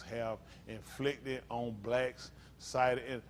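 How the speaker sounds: low, soft, halting dictionary reading; reading aloud. Real, not acted. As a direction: read an entry out haltingly, quietly. Reads dictionary entry aloud.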